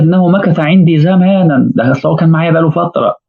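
woman's voice. Acted. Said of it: "قالت".

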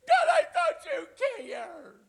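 hammed-up sobbing whine; mock wailing. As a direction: wail it like a heartbroken child. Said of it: "nothing but you complaining".

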